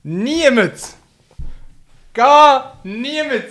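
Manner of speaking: high, loud parody shouting; mock shrieking. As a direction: ham up the friend's words. Mocking friend again. (spöttisch nachäffend)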